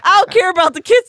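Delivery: high, loud, fast outburst; acted brash dismissal. Imitating a friend who only loves the dog, brash dismissal. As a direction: blurt it quickly at a mock shout.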